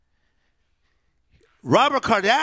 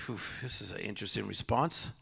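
wry inner thought; 9 semitones lower, 13 dB quieter than usual. Retold or imitated. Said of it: "I'm like".

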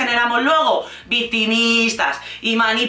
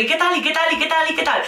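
mock fussing friends; 5 semitones higher, faster than usual. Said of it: "todo el mundo siempre".

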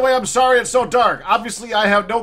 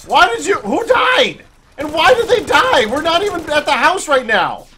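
much higher, bewildered asking; shocked questions; 6 semitones higher than usual. Real, not acted. Gamer shocked by a death message. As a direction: sound baffled and thrown.

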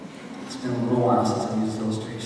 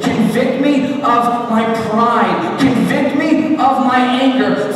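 building to a high, loud, drawn-out cry; fervent pleading prayer; 9 semitones higher, 7 dB louder than usual. Acted. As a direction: cry out to God, each plea climbing.

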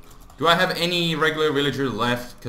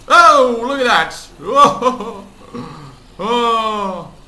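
much higher and louder, laughing; excited outburst; 8 semitones higher, 9 dB louder, slower than usual. Real, not acted. Gamer watching zombies swarm, yelling with glee. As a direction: burst out in sudden delight. Zombie horde surprises him.

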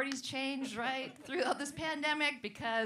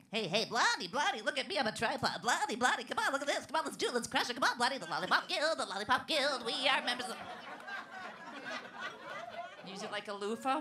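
cocky jockey come-on. Retold or imitated. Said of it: "he's like".

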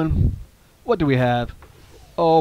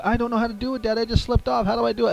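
put-on higher, whiny kid asking for help; little girl voice; 7 semitones higher, faster than usual. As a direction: play the stuck toddler. She said.

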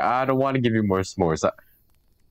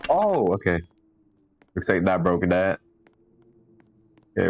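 ordinary talk, nothing marked

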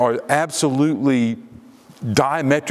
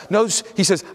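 normal talking voice

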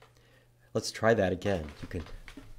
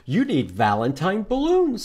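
higher, louder eager squirrel; perky squirrel piping up; 9 semitones higher, 5 dB louder than usual. Acted. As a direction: voice the excited little squirrel. Squirrel voiced in picture book.

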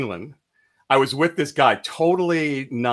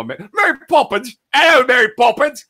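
high, hammed-up bad English accent; 10 semitones higher, 6 dB louder than usual. Host mocks a film actor's attempt, horrible English accent. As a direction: do a terribly fake English accent, pitched way up.

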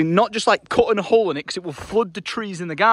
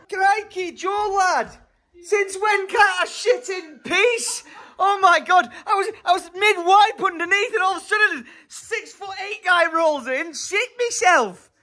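hammed-up Scottish accent, much higher; mock Scottish; 11 semitones higher, slower than usual. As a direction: play an over-the-top Scottish lad, flustered and laughing. Friend voiced Scottish after bathroom walk-in.

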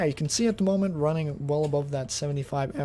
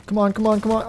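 higher, louder, quick repeated urging; urgent urging; 6 semitones higher, 7 dB louder, faster than usual. Real, not acted. Player in a gunfight with a better opponent, urgent. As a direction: urge it on in mid-fight.

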